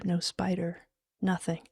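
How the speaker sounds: usual speaking voice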